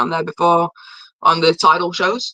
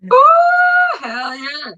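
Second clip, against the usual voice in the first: thrilled, high-pitched reaction; excited cheer; 3 dB louder, much slower than usual. Real, not acted. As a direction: whoop high with delight, then ease off. Thrilled reaction to the meeting news.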